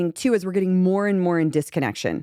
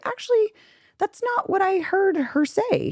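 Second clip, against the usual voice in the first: voicing a mediator; 13 semitones higher than usual. Therapist voices intervening third party.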